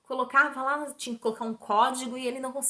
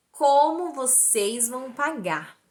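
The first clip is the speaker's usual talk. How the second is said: very slow, louder, word by word; pressing repeated question; 3 semitones higher, 5 dB louder, much slower than usual. Retold or imitated. Doctor pressing the patient a third time, very deliberate. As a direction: hammer out each word of the question. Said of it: "pela terceira vez ele me pergunta".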